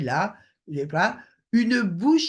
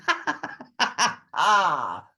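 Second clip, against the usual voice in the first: breathy mock laugh. Shown as a demonstration. Teacher clowning a laugh for the class, mock laugh. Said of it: "je vais arrêter de faire l'andouille".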